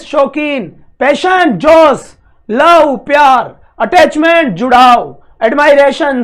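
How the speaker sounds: each word called out loud; loud drill. Shown as a demonstration. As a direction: call every word out loud for the students. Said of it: "जोर जोर से बोलो word को". (ज़ोर ज़ोर से शब्द बोलना)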